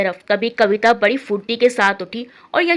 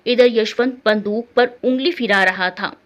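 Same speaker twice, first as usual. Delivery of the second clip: ordinary delivery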